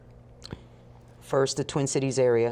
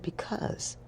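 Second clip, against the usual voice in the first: whispered coy answer; 10 dB quieter than usual. Retold or imitated.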